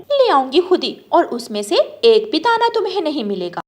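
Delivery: loud, high, fast furious retort; fuming wife snaps back at her husband, loud and fast; angry retort. Acted.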